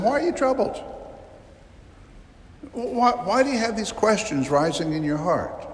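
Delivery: Jesus gently soothing his troubled disciples; gentle questioning. Acted. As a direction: reassure worried friends.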